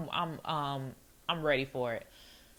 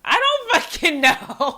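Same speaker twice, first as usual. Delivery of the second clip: loud, high, drawn-out exasperation; exasperated outburst; 10 semitones higher, 8 dB louder, slower than usual. Real, not acted. Giving up on wording the gentler text, loud exasperated outburst. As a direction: throw it out loud in frustration. Exasperated, gives up on polite version.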